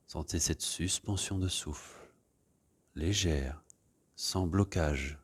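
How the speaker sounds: gentle meditation voice leading breathing; guided meditation. Acted. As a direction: ease the class into stillness. Leads a guided relaxation.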